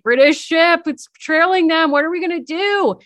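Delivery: high, loud retold alarm; mock panic. Girl seeing the British ship trailing them, panicked. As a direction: act out a panicked lookout. Acting out girl's alarm at pursuit.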